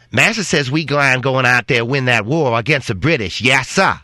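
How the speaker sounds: a mild put-on Southern dialect of a happy servant; lightly mock the film's cheerful servant; mocking movie dialect. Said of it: "they didn't talk that way".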